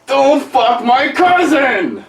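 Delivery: high, slow drawn-out yell; slow high outburst. Acted. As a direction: stretch it out, loud and high. Absurd outburst, stretched.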